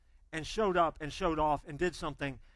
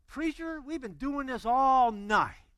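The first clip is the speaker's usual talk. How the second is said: higher, slow weary objection; exasperated fishermen; 7 semitones higher, 5 dB louder, slower than usual. Retold or imitated. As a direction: sigh out a tired protest. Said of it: "You know that was the attitude".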